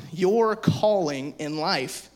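slowed way down on "your calling in life"; slow emphasis. Acted. Pastor stressing the calling to youth group, slow and deliberate. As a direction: lean on each word of "your calling in life". Preacher stressing key phrase.

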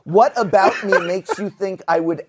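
a squeaky mock-indignant whine; indignant mock protest. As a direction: play up the offended disbelief. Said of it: "And I'm like".

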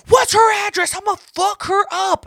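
high, loud mock fury; a hammed-up angry sister-in-law; jealous threat. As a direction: play the jealous sister-in-law ready to fight.

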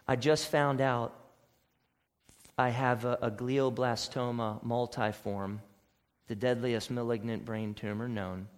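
soft, hushed friend telling the news, near a whisper at the end; quiet dying friend. Retold or imitated. Friend telling you over coffee of a terminal tumor, hushed and glad. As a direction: hush down, joy underneath.

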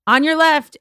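loud call to clear the path. Retold or imitated. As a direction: call it out firmly. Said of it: "I yelled at this one girl".

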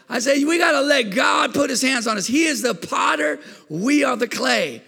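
full-force pulpit preaching. Acted. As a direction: lift the room with it. Preaching climax, shouted.

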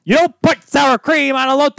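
high-pitched, emphatic outburst; indignant protest. Real, not acted. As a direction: insist on it in outrage, pitch way up. Repeats protest, higher and louder.

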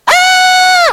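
high, loud mock scream of fright; terrified scream. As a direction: shriek like scared disciples. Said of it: "They all cried out".

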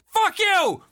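put-on high, loud furious little kid; angry toddler yell. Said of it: "super angry".

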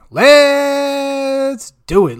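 very high, drawn-out show opener; slow, high announcer call; hype kickoff. Acted. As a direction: stretch it like a hype host. Kicking off the segment.

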